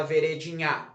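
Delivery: "veredinha" stressed on the last syllable, ve-re-di-NHÁ; wrong stress on NHA. Shown as a demonstration. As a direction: throw the beat of "veredinha" onto a final NHÁ. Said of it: "não é a última". ("veredinha" com acento errado no NHA)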